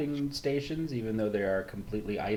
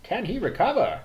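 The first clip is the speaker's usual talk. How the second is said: put-on dramatic old-timey announcer voice, higher and louder; 4 semitones higher, 4 dB louder than usual. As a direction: announce it like a vintage sportscaster. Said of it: "you sound like the announcer from the Bending Battles".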